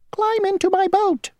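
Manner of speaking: very high, tiny, fussy insect voice; a small stickler of an insect stating the rule; mosquito voice. Acted. Mosquito ferryman laying down the rule to Grasshopper, tiny high voice.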